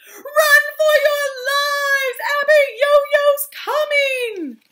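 high, drawn-out wailing yell; panicked shout. Acted. Villagers fleeing the giant, panicked shout. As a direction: yell a long warning to everyone.

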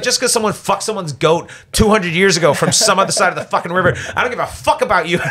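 high-pitched, incredulous retold tirade; lighting him up. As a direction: build a scornful rant at the other man. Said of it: "lit him up".

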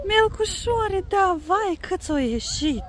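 high, loud delighted voice; excited. Real, not acted. Excited about snails with kids.

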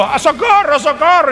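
high-pitched acted cry for help; Kevin gritando socorro. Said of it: "Imaginen que es Kevin gritando". (grito agudo fingido de socorro)

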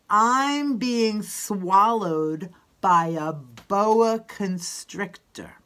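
very slow, drawn-out chant line; slow model line. Shown as a demonstration. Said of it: "I'll say it one time".

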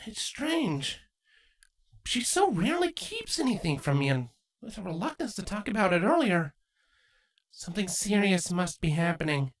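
put-on young mare lifted high and light; Twilight's voice. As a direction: voice Twilight, gently doubtful.

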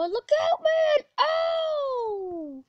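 very high, loud yell; panicked shout. Real, not acted. Gamer about to be rammed, panicked high shout. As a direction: scream a warning, then a long falling oh. Warning before a crash.

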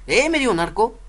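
ordinary delivery

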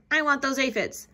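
loud high grumpy bug; grouchy ladybug. A narrator's reading. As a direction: demand it like a greedy brat. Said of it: "shouted the grouchy ladybug".

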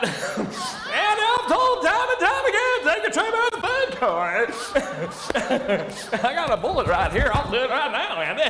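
hammed-up Mayberry deputy shout; Barney Fife impression. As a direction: play the jumpy small-town deputy. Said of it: "He's like".

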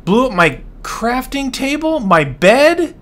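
high, incredulous questions; can't believe it's all gone; disbelieving outrage. Real, not acted. Player discovering his bed and table blown up, incredulous. Finding more items destroyed.

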